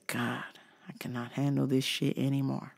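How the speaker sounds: low, very soft prayer of exhaustion; weary despair. Retold or imitated. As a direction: sigh it to God, drained. Muttered despair after customer left.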